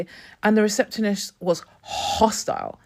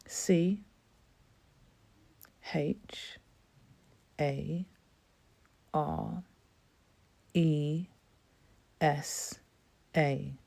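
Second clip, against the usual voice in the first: hushed spelling; 5 semitones lower, 12 dB quieter, much slower than usual. Retold or imitated. Spelling name, changed vibe.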